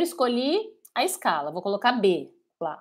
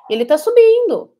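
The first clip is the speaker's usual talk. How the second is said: excited; 11 semitones higher, 10 dB louder than usual. Real, not acted. Showing the gauge rising.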